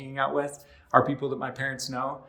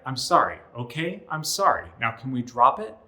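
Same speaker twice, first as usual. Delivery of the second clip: louder, impatient, fed-up sorry; exasperated apology; 3 semitones higher, 7 dB louder than usual. Shown as a demonstration. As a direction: snap out the apology to end it. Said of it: "that's a lot of times what it sounds like".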